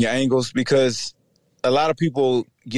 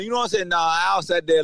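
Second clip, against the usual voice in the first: normal talking voice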